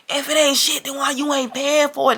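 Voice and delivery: high-pitched retold retort; irritated as hell. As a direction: argue back, fed up with splitting. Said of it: "now I'm irritated as fuck".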